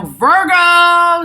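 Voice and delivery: thrilled loud exclamation; excited shout. Real, not acted. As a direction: yell the sign out with glee. Excited at a Virgo viewer.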